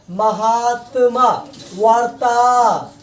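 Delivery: सीमांत and रेखांश read slowly, each syllable held; slow demo pronunciation. Shown as a demonstration. Teacher reading example words slowly to students. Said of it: "अगर इसका हम उच्चारण करते हैं".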